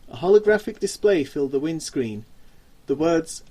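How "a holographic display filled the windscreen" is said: ordinary delivery, nothing marked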